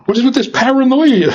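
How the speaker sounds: teasing incredulous question; incredulous mockery. Real, not acted. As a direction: scoff at their fear. Preacher mocking fearful congregants.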